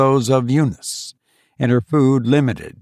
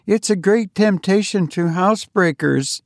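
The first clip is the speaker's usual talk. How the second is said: higher, mild warning; Eunice voiced higher, not pressing; hint of worry, higher; worried warning; 9 semitones higher than usual. A narrator's reading. Eunice warns her sister.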